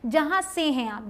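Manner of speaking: usual speaking voice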